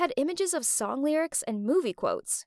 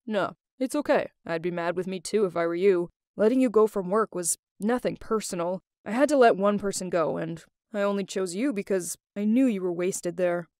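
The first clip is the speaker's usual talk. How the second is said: man's voice; 5 semitones lower than usual. Retold or imitated. Old boss Adrian voiced in story.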